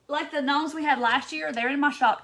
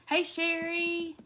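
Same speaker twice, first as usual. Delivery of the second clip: ordinary delivery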